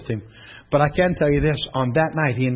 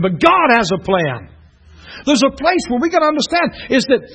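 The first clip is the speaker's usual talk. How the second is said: fired-up preaching; 9 semitones higher than usual. Acted. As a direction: preach it out to the whole room. Preaching at full force.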